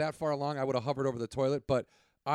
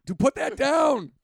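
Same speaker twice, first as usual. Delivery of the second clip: put-on voice; 11 semitones higher, 7 dB louder, slower than usual. Mock coworker voice.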